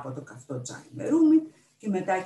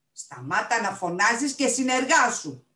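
slow, raised voice, higher; shouted order; 6 semitones higher, 6 dB louder, slower than usual. A narrator's reading. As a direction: shout for cooperation in a quarrel. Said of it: "φώναξε ο Περπερούα".

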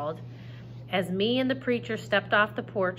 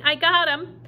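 shouting; 8 semitones higher, 7 dB louder than usual. Acted. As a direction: call out reassurance over the storm. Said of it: "she kept shouting".

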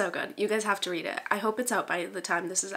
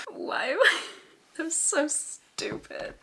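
high-pitched giggly self-scolding; laughing at oneself; 8 semitones higher, slower than usual. Real, not acted. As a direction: let laughter break up the words. Laughing at her own pun.

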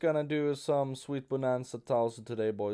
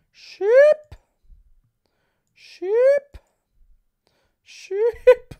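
very high squeak, much louder; squeaky high voice; 17 dB louder, much slower than usual. Pretend. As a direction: squeak it like a cartoon. Squeaky repeated word.

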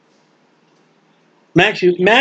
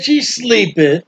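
mocking quote; slower than usual. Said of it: "He walked in there and said".

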